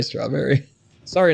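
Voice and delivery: normal talking voice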